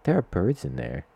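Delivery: put-on high, worried little girl voice; a small kid fretting over the birds; concerned little girl. Little girl worried about the greenhouse birds, high and concerned. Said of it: "kind of concerned and distressed for the birds".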